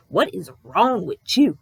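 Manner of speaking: slow, neck-rolling sassy "What is wrong with you?"; imitating her attitude. Therapist pointing at coughing patient, sassy mock accusation. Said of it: "the attitude I'm emulating and I'm imitating here".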